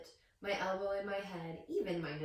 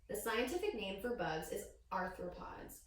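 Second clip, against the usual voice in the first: ordinary delivery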